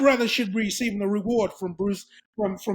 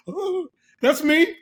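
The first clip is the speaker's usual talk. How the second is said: smitten; 7 semitones higher, 3 dB louder, much slower than usual. Retold or imitated. Teen melting at girls on doorstep.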